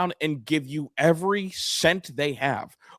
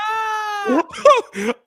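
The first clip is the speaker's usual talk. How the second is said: a high shrieking burst of laughter; 6 dB louder than usual. Real, not acted. Laughing cohost erupts at the ridiculous remark, shrieking laugh. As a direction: scream with laughter, much higher and louder.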